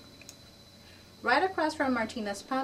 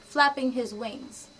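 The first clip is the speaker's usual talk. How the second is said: usual speaking voice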